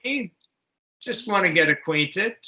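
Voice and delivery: usual speaking voice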